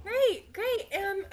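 high, loud chirpy gush; gushing Skyla voice. Acted. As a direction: squeal it out delightedly.

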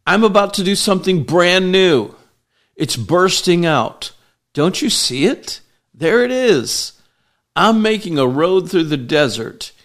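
full-force preaching. Acted. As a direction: proclaim it like a prophet to the crowd. Preaches the Message verse.

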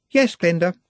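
put-on nervous young Juliet rushing her excuses; anxious young girl. A narrator's reading. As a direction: babble it out like a flustered young girl. Juliet voiced in story.

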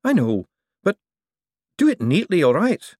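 put-on a young buyer pushing back; young man's voice. Young man insisting to the old forger, impatient.

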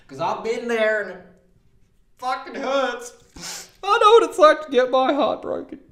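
high, strained voice, breaking into a wail on heart broken; mock heartbreak. Comic telling the audience about being dumped, hammed-up wail.

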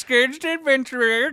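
high, loud, goofy proclamation; adventurer character voice. Acted. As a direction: announce yourself as the absurd adventurer. Announcing the character in his voice.

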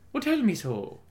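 high-pitched put-on Irish lilt; playful stab at an Irish accent. Shown as a demonstration. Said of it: "figure out how to use that accent".

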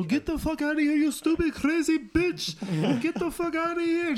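high, loud mock yell; cabbie yelling. Cab driver yelling at a crazy woman, high loud mock yell. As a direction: scream it at her from the garage. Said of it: "you hear the cab go".

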